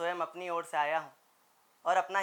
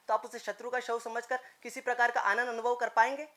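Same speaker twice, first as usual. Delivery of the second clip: usual speaking voice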